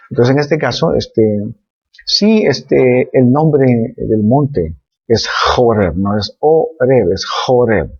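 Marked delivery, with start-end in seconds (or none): 3.1-7.9 slow, deliberate correction "Joreb" with a throaty J; slow, emphatic. Shown as a demonstration. Teacher slowly correcting the mountain's name for students. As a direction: spell it out slowly, stressing "Joreb" and "Oreb". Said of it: "pronúncialo bien".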